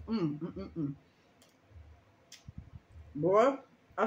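talking while chewing sandwich; words muffled by food; mouth full of food. Real, not acted. Seen 0: Bites sandwich at -4; cheeks bulge chewing at 0.1–0.9, then talks.

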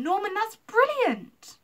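loud, high, drawn-out delight; slow, soaring cry of admiration; thrilled. A narrator's reading. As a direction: burst out with excitement. Lucy grabs his arm, thrilled.

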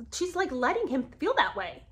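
ordinary delivery

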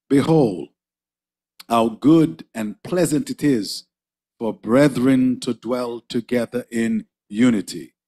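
slow, solemn scripture read; slow formal Bible reading. Acted. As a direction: read it out to the congregation. Reads psalm aloud.

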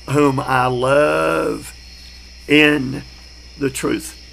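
drawn-out, deliberate verse reading; slow scripture reading. Shown as a demonstration. Preacher to class, reading scripture slowly. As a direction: read the verse slowly and reverently.

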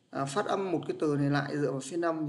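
ordinary talk

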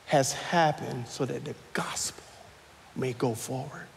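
soft, breathy whisper; hushed whisper. Acted. Pastor voices Paul's words to the church, whispering. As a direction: drop to a near-silent whisper.

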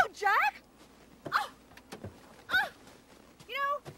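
normal talking voice

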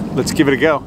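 usual speaking voice

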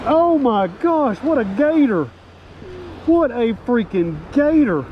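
high, drawn-out oh my gosh; awed excitement. Real, not acted. Staring at the giant trout.

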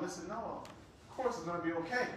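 soft, low comforting; gentle reassurance. Retold or imitated. Husband comforts his wife with kind words, soft and low. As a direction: reassure her tenderly.

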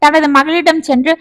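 ordinary delivery

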